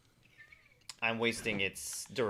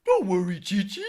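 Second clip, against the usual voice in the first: Ox King voice; 9 semitones higher, 8 dB louder than usual. Retold or imitated. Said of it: "I can do the Ox King".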